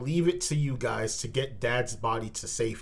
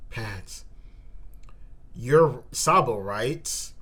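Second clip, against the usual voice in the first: normal talking voice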